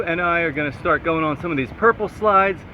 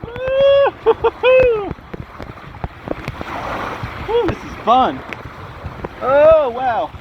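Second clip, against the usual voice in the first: excited whooping; 12 semitones higher, 5 dB louder, much slower than usual. Real, not acted. Riding down a tube slide.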